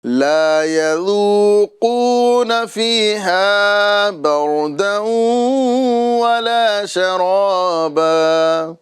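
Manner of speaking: slow, melodic tajweed chant; Quranic recitation. Shown as a demonstration. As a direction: recite it measured and drawn out. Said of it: "اس طرح پڑھیں گے".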